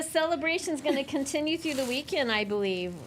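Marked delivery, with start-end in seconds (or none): none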